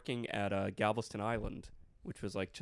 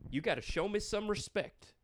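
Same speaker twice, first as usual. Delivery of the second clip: high-pitched cop imitation at normal volume; stern cop, repeated; 10 semitones higher than usual. Retelling the cop's demand to friends, high mock-stern voice. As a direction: echo the lawman's scolding, less forcefully.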